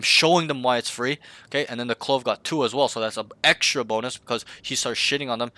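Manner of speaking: ordinary delivery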